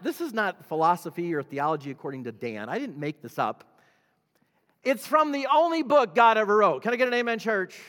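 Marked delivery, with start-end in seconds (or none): 4.8-7.8 high, loud rallying call; rousing preaching. Real, not acted. Preacher pressing the church for an amen, high and forceful.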